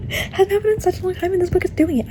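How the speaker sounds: high, choked-up voice; getting emotional. Real, not acted. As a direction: laugh a little through real emotion. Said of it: "I'm actually getting emotional".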